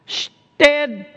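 "steadfast" broken into drawn-out parts, pitched high; preacher's slow, high emphasis on "steadfast"; emphasized word. Shown as a demonstration. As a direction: hit "steadfast" hard by splitting it, STEAD... FAST. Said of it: "we're gonna emphasize this first word".

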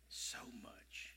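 softly whispered. Real, not acted. Preacher assuring the congregation, whispered. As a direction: lean in and breathe it.

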